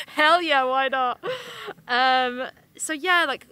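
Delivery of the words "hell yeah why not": louder, higher mock cheer of the game makers; gleeful cheer. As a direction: whoop it out like a carefree developer. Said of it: "Why they were just like".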